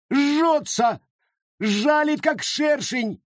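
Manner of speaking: high piercing shriek. A narrator's reading. Jane Foster shrieks at the crowd, high and piercing. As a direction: scream like you're stung. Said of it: "завизжала Джейн Фостер".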